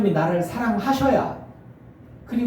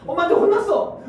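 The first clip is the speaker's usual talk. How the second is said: put-on high, whimpering little-kid voice; imitated tearful daughter; mock crying child; 11 semitones higher, 4 dB louder than usual. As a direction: pretend to sob after a scolding. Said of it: "하고 울어".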